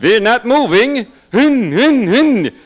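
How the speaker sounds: a grunt trying to keep morale up; soldier at the front. Acted. Soldier at the front line, slow dazed grunting.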